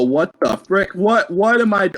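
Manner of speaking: usual speaking voice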